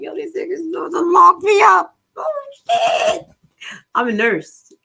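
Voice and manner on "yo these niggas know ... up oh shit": high, loud alarmed yell; loud shocked panic, much higher; panicked shout. Retold or imitated. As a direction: shout in disbelief.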